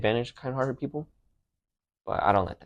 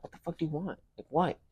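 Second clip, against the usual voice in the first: higher, mock angry retort at his mom; retold angry snap; 8 semitones higher, 5 dB quieter than usual. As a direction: replay the fight's irritated snap, pitched up. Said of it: "I'm like".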